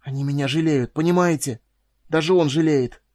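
bitter, heated complaint rising in pitch; fierce outburst. A narrator's reading. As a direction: build to loud, furious indignation. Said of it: "зашептал он яростно".

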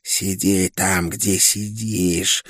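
slow, menacing hiss. A narrator's reading. Okul orders the prisoner to stay put, hissing slowly. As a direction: draw each word out in a sinister hiss. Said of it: "прошипела Окул".

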